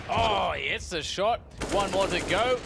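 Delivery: high, loud hype call on the shot; excited. Acted. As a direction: erupt as the shot lands. Caster reacts to big shot.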